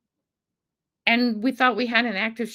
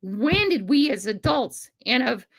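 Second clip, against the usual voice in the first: ordinary talk, nothing marked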